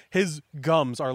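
ordinary talk

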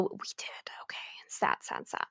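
soft whispered sad, sad; whispered sadness. Real, not acted. Vlogger admitting they never went back, whispered. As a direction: breathe it out as a mournful whisper.

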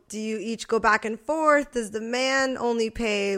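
ordinary delivery

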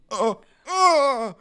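high gasping laugh; laughing gasps. Retold or imitated. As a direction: wheeze it out through laughter. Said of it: "it was just like".

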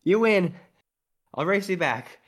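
put-on girl NPC voice. NPC girl challenges, sprints off.

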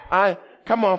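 ordinary talk

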